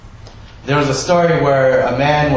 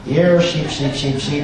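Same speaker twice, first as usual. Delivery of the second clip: imitated Minnesota sheep call; Minnesota accent. Imitating father-in-law from Minnesota calling his sheep, Minnesota accent. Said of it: "That's my Minnesota accent".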